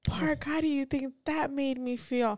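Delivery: high mock whine of a sulky little girl; whiny child. Mom mocking daughter's complaint to podcast co-host, high whine. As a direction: ham up the kid's guilt trip, pitched high. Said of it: "She's like".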